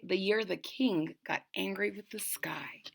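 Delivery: breathy hushed whisper; soft storyteller's whisper. A narrator's reading. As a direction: whisper it like a secret to the kids. Whispered retelling of the title.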